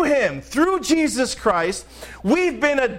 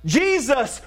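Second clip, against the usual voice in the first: ordinary talk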